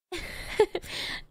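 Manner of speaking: airy, embarrassed little laugh; breathless bashful chuckle; breathy laugh. Acted. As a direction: laugh it off after the confession. Villain after saying I love you.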